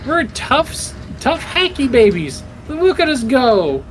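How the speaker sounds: excited cheer. Real, not acted. Celebrating finishing the minigame.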